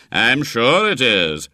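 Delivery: slow, loud mock agreement; sardonic. A narrator's reading. Doctor humouring a boy he disbelieves, sardonic. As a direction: drag it out with heavy disbelief.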